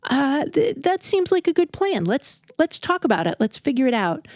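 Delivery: high, eager, overly agreeable stammering; fake agreeable. Bob humouring the bosun's murder plan, fake eager. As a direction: play along too brightly, hiding panic. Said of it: "He pretends he's okay with it".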